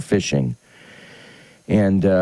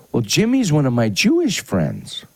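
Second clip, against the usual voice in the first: put-on a lightly raised boy's voice; little boy voice; 7 semitones higher than usual. Grandson explaining to grandpa, lightly voiced as a boy.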